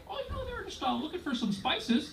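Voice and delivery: very high, soft, prissy excuse-making; mock textbook voice. Textbooks excusing explorers, high soft prissy voice. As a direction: sugarcoat it like a dainty textbook. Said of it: "our textbooks will still say".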